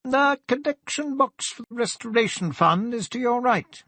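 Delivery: high, carrying call across the church; vicar calling out. A narrator's reading. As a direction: call it out from behind him, pitched well up. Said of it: "he heard the vicar call out from behind him".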